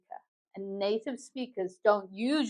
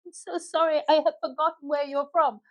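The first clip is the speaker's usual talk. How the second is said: higher-pitched, polite mock apology; apologetic forgetful questioner; 6 semitones higher than usual. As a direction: act out a sorry, curious question. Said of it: "you don't remember where they're from".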